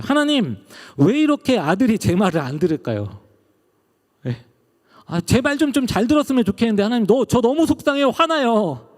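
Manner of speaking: high, pleading, close to tears; tearful prayer. Retold or imitated. Pastor praying in a back room after a fight with his son, high and tearful. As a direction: cry out to God, upset with a son. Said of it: "울면서 기도했어요".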